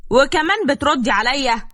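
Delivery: how dare she talk back; outraged. A narrator's reading. Husband outraged that his wife answers back.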